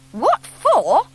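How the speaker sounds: high, rising indignant repeat; indignant echo. A narrator's reading. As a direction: throw the question back, amazed. Scout echoes the question, indignant.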